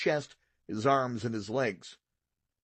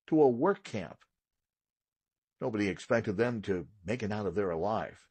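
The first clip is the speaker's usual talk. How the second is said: ordinary delivery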